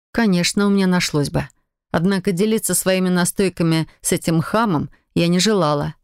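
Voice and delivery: ordinary delivery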